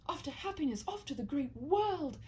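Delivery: ordinary delivery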